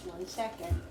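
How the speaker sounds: usual speaking voice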